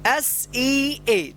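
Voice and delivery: each letter held long; drawn-out, separate letters; slow spelling. Shown as a demonstration. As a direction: spell it very slowly for learners. Teacher spells out word for learners.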